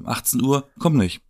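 normal talking voice

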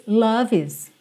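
"love is" run together, V into "is"; linked love is. Shown as a demonstration. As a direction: join "love" to "is". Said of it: "junção de love com is". ("love is" ligado)

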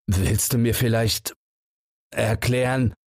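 a hint of breathlessness after laughing; out of breath. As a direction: squeeze out the last breath. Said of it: "sagt mühsam mit dem letzten Atem". (Holzer fragt mühsam, außer Atem vom Lachen)

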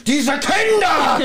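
high, slow furious shout; angry uncle shout. Retold or imitated. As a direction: bellow at the children. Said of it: "dann kommt ja wieder Onkel Quentin raus".

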